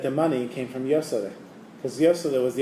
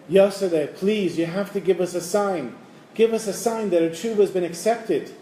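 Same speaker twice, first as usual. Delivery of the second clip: pleading; 6 semitones higher than usual. Retold or imitated. Rabbi begs at the open ark.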